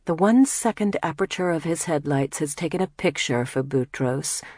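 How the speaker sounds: ordinary delivery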